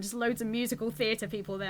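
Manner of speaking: usual speaking voice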